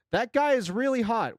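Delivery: sudden excited burst. Real, not acted. Sudden exclaim reading chat.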